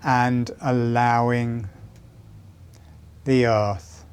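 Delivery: calm, unhurried meditation guide with long pauses; measured meditation voice, letting each phrase settle; guided meditation. Real, not acted. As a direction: guide them inward phrase by phrase, leaving space between.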